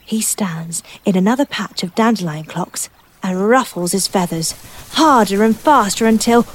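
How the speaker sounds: ordinary delivery, nothing marked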